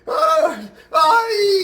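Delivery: very high, loud "ay" wail; hammed-up Italian cry of doom; mock despairing scream. Italian guy jumps off the roof, mock despairing scream. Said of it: "and he goes".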